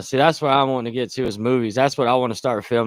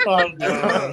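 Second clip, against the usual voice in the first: laughing; 6 semitones higher than usual. Real, not acted. Cracking up at Tyson story.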